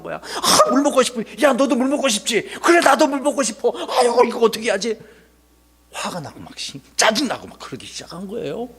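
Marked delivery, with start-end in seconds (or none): 0.2-5.0 crowd of thirsty grumblers, pitched high; acted grumbling. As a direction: complain to each other in a raised voice. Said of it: "여기저기서 막 불평이 일어나기 시작한". (높은 목소리로 투덜대는 불평)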